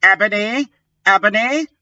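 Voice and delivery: high anxious calling; worried. Acted. Draco checks on Ebony in a high worried voice. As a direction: ask after her, worried and up high. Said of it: "in a worried voice".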